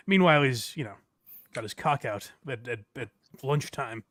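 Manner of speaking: usual speaking voice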